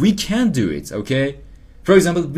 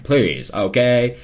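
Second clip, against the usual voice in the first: usual speaking voice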